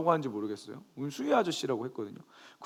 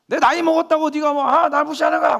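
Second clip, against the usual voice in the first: put-on high, loud angry old man shouting; loud, high mock shout of an angry guard; retold angry yelling; 11 semitones higher, 8 dB louder than usual. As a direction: yell it like an offended guard. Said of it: "막 소리를 막 지르시는 거예요".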